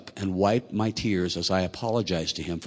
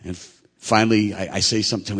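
ordinary talk

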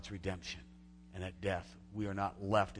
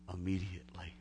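whisper; 5 semitones lower, 6 dB quieter than usual. Real, not acted. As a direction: drop to a hushed whisper. Quiet stress on immediacy.

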